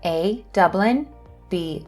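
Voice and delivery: normal talking voice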